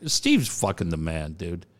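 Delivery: low, tossed-off line that runs words together; said too fast. Real, not acted. Radio host raving about Steve to co-host, said too fast. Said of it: "I said that so fast".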